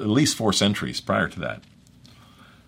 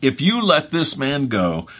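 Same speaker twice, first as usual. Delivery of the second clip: higher, slow acted outcry; measured crowd demand; crying out; 7 semitones higher, 3 dB louder, slower than usual. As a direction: press the warning out slowly, pitched up.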